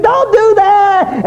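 very high, stretched-out screamed plea; pained scream. Retold or imitated. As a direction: scream at the nurse to stop, slowly. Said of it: "He'd scream".

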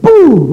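loud, high, sudden boom; explosion noise. Retold or imitated. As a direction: burst out an imitated blast. Imitates the chemical exploding.

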